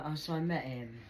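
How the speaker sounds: usual speaking voice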